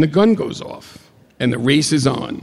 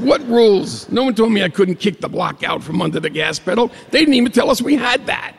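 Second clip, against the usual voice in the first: indignant outburst; 8 semitones higher than usual. Retold or imitated. Arguing with Jake, indignant.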